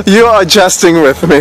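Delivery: laughing. Real, not acted. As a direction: laugh through the teasing. Laughing flirtation with man.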